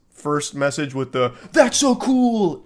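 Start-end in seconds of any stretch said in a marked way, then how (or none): 1.5-2.6 high, slow mock game-character gushing; stretched-out, pitched-up fake enthusiasm; NPC dialogue. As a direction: parody a canned, overexcited NPC line. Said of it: "N P C dialogue".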